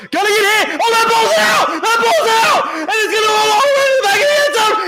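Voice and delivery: excited fumble call. Real, not acted. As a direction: yell it as the ball comes loose. Fumble call, live radio.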